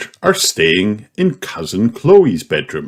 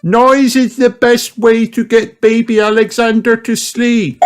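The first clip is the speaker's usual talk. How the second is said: much higher motherly voice; Auntie Pig; 3 dB louder than usual. Acted. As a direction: announce bedtime like a busy aunt. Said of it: "she says".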